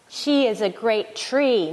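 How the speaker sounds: usual speaking voice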